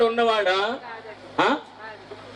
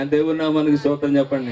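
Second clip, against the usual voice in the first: normal talking voice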